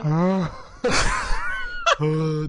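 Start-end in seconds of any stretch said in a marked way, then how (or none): 0.6-1.9 hohes, lautes Kreischlachen; shrieking laugh. Real, not acted. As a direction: quietsch vor Lachen los. Kreischendes Lachen nach Streit. (schrilles Auflachen)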